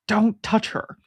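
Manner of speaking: high, intense quoted line; quoted character line. Retold or imitated. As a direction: say it like the show's character. Quoting a show line.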